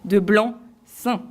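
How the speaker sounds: "blanc seing" said very slowly, the French way; slow, careful correction. Shown as a demonstration. Corrected student carefully pronouncing the term at last. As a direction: spell out "blanc seing" deliberately at half speed. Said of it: "On me corrige rapidement".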